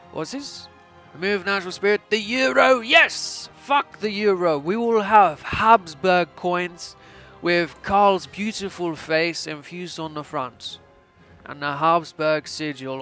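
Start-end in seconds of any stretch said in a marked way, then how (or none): 1.1-7.0 put-on loud, raised, gleeful decree in Austrian accent; mock triumphant proclamation. Austrian ruler abolishing the euro for Habsburg coins, triumphant. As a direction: proclaim it like a victorious emperor.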